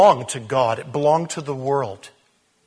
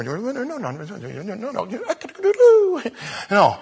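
1 laughing denials; 5 semitones higher than usual. Retold or imitated. String of comic denials.

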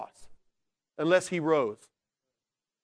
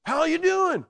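high, bright mock greeting; cheery greeting; 12 semitones higher, 5 dB louder than usual. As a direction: chirp it like a friendly hello.

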